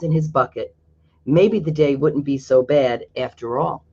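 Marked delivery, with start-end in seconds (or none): none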